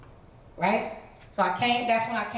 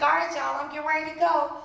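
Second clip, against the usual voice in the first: cheerful goodbye; 7 semitones higher, 3 dB louder than usual. Retold or imitated. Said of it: "I was like".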